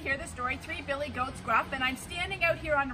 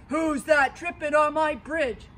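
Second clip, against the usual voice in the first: loud troll challenge; troll challenging the second goat, loud; troll roar; 3 semitones higher, 9 dB louder than usual. Acted. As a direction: bellow from under the bridge.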